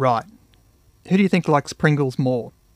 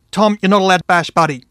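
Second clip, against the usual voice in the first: louder, higher stern command; firm shout; 5 semitones higher, 8 dB louder than usual. A narrator's reading. Dad holding Tom back, loud stern shout. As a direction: shout him down as you hold him.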